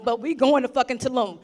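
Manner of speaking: ordinary talk, nothing marked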